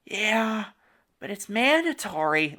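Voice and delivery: high, soft imitation of a timid woman; Phyllis Smith's timid voice. Film fan imitating the actress's lunch scene, timid voice. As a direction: shrink into a small, high, timid voice. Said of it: "like, timid voice".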